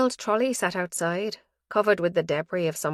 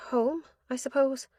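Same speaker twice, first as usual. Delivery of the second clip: higher, a lightly voiced grieving young woman; Sue's voice; 8 semitones higher than usual. Sue tells the detective where she will go, lightly voiced and resigned. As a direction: say home as a resigned shrug.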